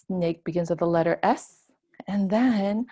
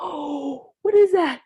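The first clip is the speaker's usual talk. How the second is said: higher, louder gasping question; mock surprise; 7 semitones higher, 4 dB louder than usual. Teacher pretending to be startled by a snake, surprised. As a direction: act startled by it.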